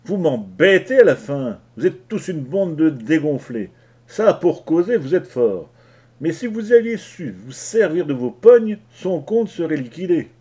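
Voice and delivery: éclat de colère. Acted. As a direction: explode at the whole crew. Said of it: "il éclata". (éclat de colère, fort et aigu)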